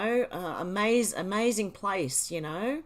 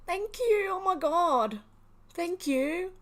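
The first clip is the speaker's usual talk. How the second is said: high, breathless-sounding burst of gratitude; excited thanks; 9 semitones higher, slower than usual. Real, not acted. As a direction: thank him again, still stunned. Still reacting to big donation.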